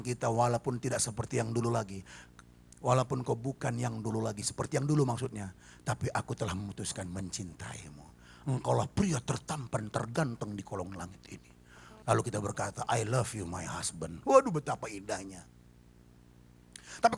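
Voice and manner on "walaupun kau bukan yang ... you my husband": low, soft loving vow; tender wife. Acted. Wife telling her husband he is handsomest, soft and low. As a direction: murmur the vow softly and low.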